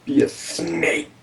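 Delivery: hissed, slow, unvoiced whisper. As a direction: breathe it like a snake. Whispered snake command to player.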